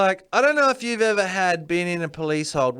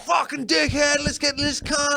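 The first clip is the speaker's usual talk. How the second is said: higher, yelled crowd insult; mock angry crowd shout; 7 semitones higher than usual. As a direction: bark it like a furious mob.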